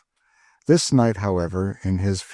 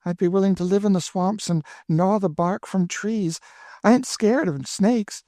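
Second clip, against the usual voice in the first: put-on high, eager girlish rush; 12 semitones higher than usual. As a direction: swear you'd brave the snakes. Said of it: "said Emmeline eagerly".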